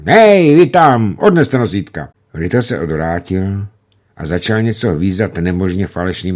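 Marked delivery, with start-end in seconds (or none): 0.0-2.1 high, loud call across the airfield. A narrator's reading. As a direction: shout for the stretcher bearers. Commander calls for stretcher. (velitel volá přes letiště hlasitě)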